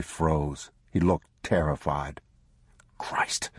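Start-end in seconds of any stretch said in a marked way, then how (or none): 3.0-3.5 breathless whispered oath; whispered curse. Acted. Father realizing the pistol is gone. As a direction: whisper it in dismay.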